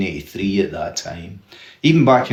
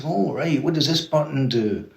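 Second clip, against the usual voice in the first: higher-pitched mock curiosity; keen interest; 5 semitones higher, 5 dB quieter than usual. Dad asking his sons about the new gadget, interested. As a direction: imitate an interested dad, voice lifted. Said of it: "he was really interested".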